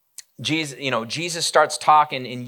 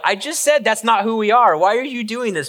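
higher, louder frustrated scolding; exasperated rebuke; 9 semitones higher, 6 dB louder than usual. Acted. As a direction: scold him like a fed-up teacher. Jesus rebuking Peter at arrest.

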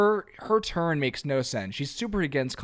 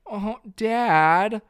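high, louder whiny cry to his dad; exasperated whine; 7 semitones higher, 6 dB louder than usual. Retold or imitated. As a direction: groan at dad's blunder, louder and higher. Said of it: "And then he goes".

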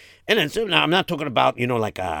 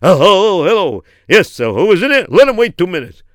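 loud, higher-pitched boss voice; Geltmacher impression; 7 semitones higher, 9 dB louder than usual. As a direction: bluster loudly like a busy old boss. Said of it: "you made Geltmacher sound like".

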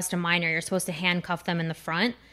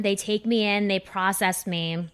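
usual speaking voice

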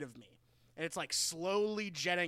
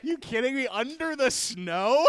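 higher, louder, laughing disbelief; incredulous; 7 semitones higher, 6 dB louder than usual. Real, not acted. Host repeating cohost's answer in laughing disbelief.

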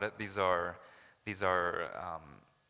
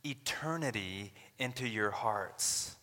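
voicing Paul; 5 semitones higher than usual. Paul to the Corinthians, pleading and incredulous. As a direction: plead like the apostle, rising in disbelief. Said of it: "Paul's saying".

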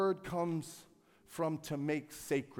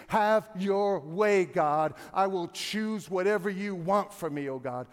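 slow, louder surrender prayer; lifted-up prayer to God; prayerful plea; 2 semitones higher, 8 dB louder than usual. Acted. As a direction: pray it out, drawing out the first words.

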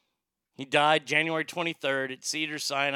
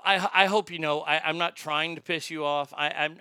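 ordinary talk

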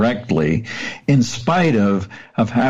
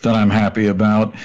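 ordinary talk, nothing marked

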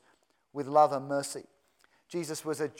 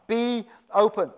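slow, firm command, pitched up; loud, deliberate word of authority; 5 semitones higher, 5 dB louder than usual. Retold or imitated. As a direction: give it as a weighty order. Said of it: "He just commands".

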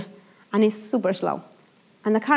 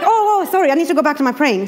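flustered catch; 5 semitones higher, 4 dB louder, faster than usual. Retold or imitated. Catching herself, laughing.

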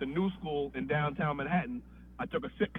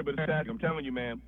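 ordinary talk, nothing marked

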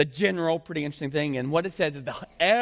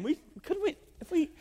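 much higher, mock whine; whiny Israelites; 14 semitones higher, 3 dB quieter than usual. Preacher mimicking grumbling Israelites, high whine. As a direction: squeak it out like a complainer.

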